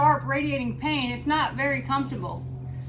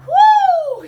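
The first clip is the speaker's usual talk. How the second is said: long, high, loud "woo"; exertion whoop; 14 dB louder than usual. Real, not acted. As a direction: whoop it out after a hard set. Relief after hard exercise set.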